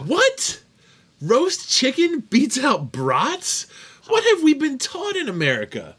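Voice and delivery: high, loud, incredulous protest; mock outraged disbelief. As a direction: squeal as if you've been lied to all your life. Mock outrage at quiz answer.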